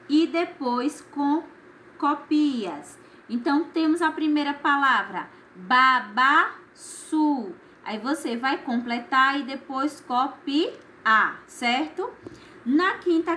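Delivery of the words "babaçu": very slow "babaçu", syllables drawn apart. Shown as a demonstration. Teacher reading the first word to complete, syllable by syllable. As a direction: sound out "babaçu" one stretched syllable at a time.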